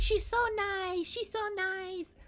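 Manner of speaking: high, slow swooning mimicry; gushing mock fan. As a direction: gush like a fawning student. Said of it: "She's like".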